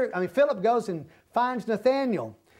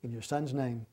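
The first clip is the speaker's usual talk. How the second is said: closing prayer; 4 semitones lower than usual. Real, not acted. Thanking the Father as the chapter study ends, prayerful.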